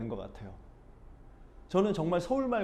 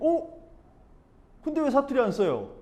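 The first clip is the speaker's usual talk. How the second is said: high, louder astonished "어?"; surprised; 9 semitones higher, 4 dB louder than usual. Retold or imitated. Seoul friend hearing he is from Busan, surprised and loud. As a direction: sound caught off guard by the news.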